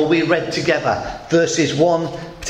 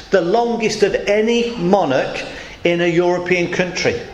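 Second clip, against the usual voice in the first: ordinary talk, nothing marked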